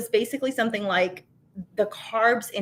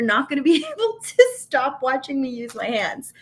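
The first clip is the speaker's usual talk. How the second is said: laughing; 4 semitones higher than usual. Real, not acted. Laughing at herself.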